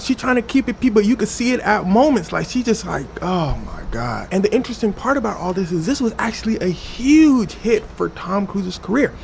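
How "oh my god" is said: low, slow mock groan; stressed-out dismay. Stressed Oprah watches the Tom spectacle, slow dismayed groan. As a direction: drag it out in weary dread. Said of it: "Oprah clearly stressed out".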